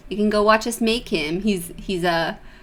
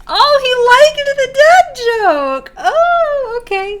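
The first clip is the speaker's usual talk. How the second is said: very high, loud, drawn-out gushing; thrilled high-pitched cooing; delighted squeal; 7 dB louder, slower than usual. Real, not acted. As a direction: squeal with glee, stretching it out. Gushing over the dad joke landing.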